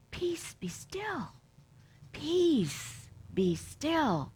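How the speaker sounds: slow hushed calming whisper; peace be still. Retold or imitated. Jesus telling the disciples to say peace be still. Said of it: "Just say, think, and feel".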